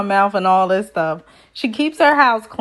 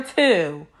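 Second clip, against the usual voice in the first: usual speaking voice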